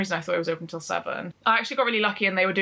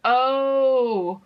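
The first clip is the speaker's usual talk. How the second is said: long, drawn-out higher OH; sudden penny-drop "oh"; dawning realisation; 5 semitones higher, 4 dB louder than usual. Retold or imitated. Realising he meant tattoos, drawn-out dawning realisation.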